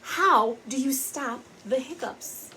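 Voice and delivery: ordinary delivery, nothing marked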